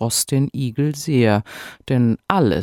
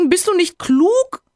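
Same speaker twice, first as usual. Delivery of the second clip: shrill-high, louder shocked cry; screaming wife; 4 dB louder than usual. A narrator's reading. Hedgehog's wife hears about the bet, screaming. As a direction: wail at him in horror. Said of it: "fing nun des Igels Frau an zu schreien".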